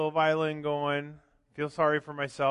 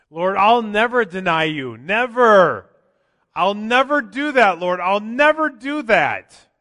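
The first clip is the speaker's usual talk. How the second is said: loud, higher, insistent promise; Peter's vow; 7 semitones higher, 13 dB louder, slower than usual. Retold or imitated. Peter vowing to the Lord, loud and insistent. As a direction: swear it with full force, slowing at the end. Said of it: "Or how about Peter?".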